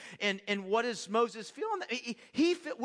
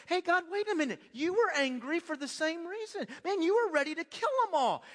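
higher, a touch louder, arguing; mock protest to God; 8 semitones higher, 3 dB louder than usual. Preacher arguing with God, higher and pushy. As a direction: call God out as if catching him out. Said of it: "what I wanna do is I wanna say".

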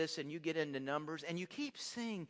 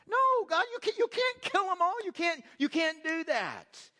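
high, loud urgent plea; pleading Moses; 9 semitones higher, 5 dB louder than usual. Retold or imitated. Moses pleading with an angry God, high and urgent. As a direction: beg God to stop, voice jumping way up.

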